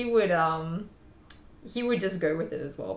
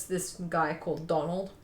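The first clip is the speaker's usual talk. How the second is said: usual speaking voice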